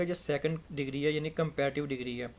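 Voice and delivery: usual speaking voice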